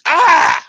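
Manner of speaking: sudden loud, high-pitched scream; waking scream. Acted. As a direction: jolt awake with a piercing yell.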